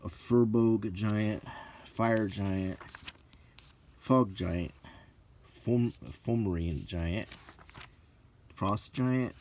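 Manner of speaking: ordinary delivery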